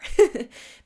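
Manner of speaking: loud, short teary laugh; a burst of laughing through tears; tearful laugh. Real, not acted. Vlogger embracing her vulnerability, short teary laugh. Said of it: "And I don't care".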